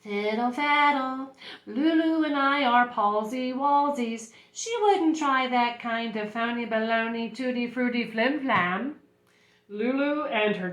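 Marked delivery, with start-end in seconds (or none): 0.0-8.9 a hint of a soothing nurse; unruffled nurse. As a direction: brush it off smoothly and unhurriedly. Voices the smiling nurse.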